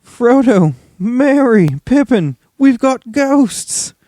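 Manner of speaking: high, loud frantic calling; panicked shouting. A narrator's reading. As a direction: scream for help. Sam shouting for friends.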